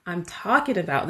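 mock yell at a phone, loud at first; retold excited yell. Podcaster retelling her reaction to the video, excited mock yell. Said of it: "screaming at my phone".

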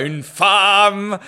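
hammed-up awe, holding the word "femme"; mock reverence. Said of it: "ça la sacralise magnifiquement". (déclamation pompeuse, « femme » longuement étiré)

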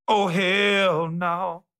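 drawn-out, high hell no; emphatic refusal. Retold or imitated. He turns down Oprah's offer with a slow, high no way. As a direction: stretch it out like no way on earth.

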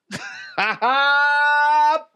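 high burst of laughter; laughing. Real, not acted. Host cracking up after his mom voice, high laugh. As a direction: burst out laughing at your own bit.

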